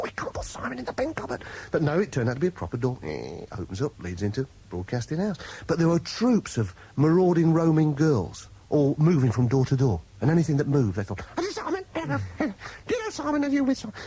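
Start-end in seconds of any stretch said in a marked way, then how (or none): 0.0-1.4 whisper. Retold or imitated. Whispered thought about hiding Simon. 11.4-13.9 put-on very high squeaky girlish voice asking; squeaky fan girls. Girls at the door asking everyone for Simon, in a high squeaky voice.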